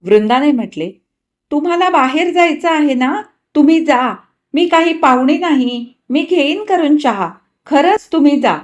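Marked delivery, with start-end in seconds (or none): 1.5-8.6 higher, louder earnest urging; Vrunda insisting. Acted. Vrunda urging the couple to go out, louder. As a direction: urge them to go, sincerely.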